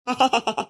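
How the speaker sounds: short amused chuckle; hearty laugh. Acted. The boss laughs at his men's joke, short chuckle. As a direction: laugh at the boys' boasting.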